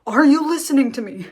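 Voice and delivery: mock commanding doctor demanding focus; firm doctor. Doctor to hyperventilating mother, firm and urgent. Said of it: "the doctor is like".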